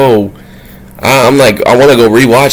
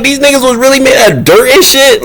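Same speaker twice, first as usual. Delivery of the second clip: high-pitched incredulous question; acted disbelief; 12 semitones higher than usual. Vlogger replaying his shock about the dirt, disbelieving. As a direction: keep it high, as if astounded. Acts out astonished reaction.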